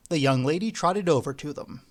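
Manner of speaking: ordinary delivery, nothing marked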